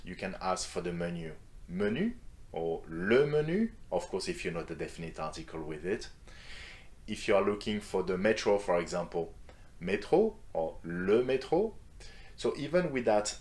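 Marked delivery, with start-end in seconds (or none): 1.7-3.7 "Menu" and "le menu" each rising high at the end; questioning rise. Shown as a demonstration. French teacher asking in a restaurant, rising question tune on menu. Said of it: "even do that with just a single word". 9.8-11.7 "métro" and "le métro" each rising high at the end; questioning rise. Shown as a demonstration. French teacher looking for the metro, rising question tune on métro. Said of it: "even do that with just a single word".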